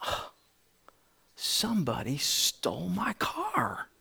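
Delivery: breathless whisper, slow and hushed; a shocked whisper to himself; whispered thought. Retold or imitated. Thinking his car was stolen.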